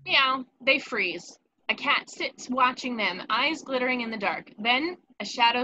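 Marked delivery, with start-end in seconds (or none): 0.1-0.4 a loud, sudden mock meow; cat meow. A cat startling the kids in the dark, loud meow. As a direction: mew like the caretaker's cat.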